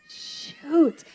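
squeaky high yelp of shock; a kid shocked at her own swearing; mock shocked curse. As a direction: gasp it out like a scandalized kid. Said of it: "I said my first curse word".

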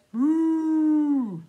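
long, loud moo; cow noise. Shown as a demonstration. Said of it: "and the cow says".

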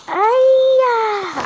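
high, loud "ay, ah"; long falling cry of alarm; alarmed wail. Real, not acted. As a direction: cry out high as disaster looms. Near miss in the game.

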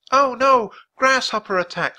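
loud, high-pitched cry of alarm; alarmed scream. A narrator's reading. Kate warns the class of grasshoppers, alarmed scream. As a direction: scream a warning in storybook panic. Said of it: "screamed Kate".

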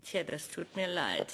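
ordinary delivery

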